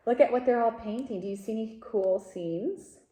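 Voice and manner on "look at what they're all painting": ordinary delivery, nothing marked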